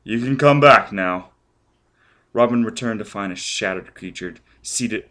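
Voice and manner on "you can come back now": louder call across the beach; loud call carried over the surf. Acted. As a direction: call her back from a distance. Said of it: "Foyle called to her".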